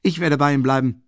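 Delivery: loud, high, eager exclamation; calling out. A narrator's reading. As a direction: call it out with force. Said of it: "rief Rasumichin".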